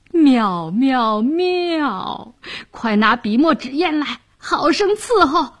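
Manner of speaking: delighted official. A narrator's reading. Official calling for brush and ink, delighted. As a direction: crow with delight, then call out orders.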